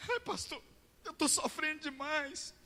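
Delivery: very high, slow whimpering voice; playing up his self-pity; crying man. Bedridden disciple whines to the pastor, high and slow. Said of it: "ele começou a chorar".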